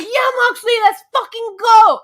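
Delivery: big excited yell at the wrestler; yelling to hype him up; hype shout. Retold or imitated. Fan yelling to hype up Moxley beside her, full shout.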